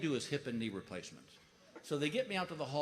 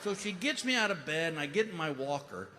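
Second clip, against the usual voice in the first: normal talking voice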